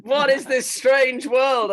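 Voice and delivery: ordinary talk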